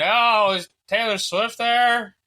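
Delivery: high, drawn-out mock excitement; mocking imitation. Mimics fans' annoying question during football.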